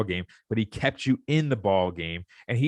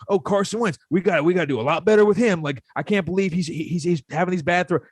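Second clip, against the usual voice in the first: mock coach; 5 semitones higher than usual. Fan mocking the coach's excuses, higher and hammed-up. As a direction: ham up a clueless coach griping. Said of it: "you go".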